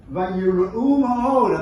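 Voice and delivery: very slow and loud, stretching ma'od; drawn out. Shown as a demonstration. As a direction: savour the words of the verse. Reading the verse with feeling.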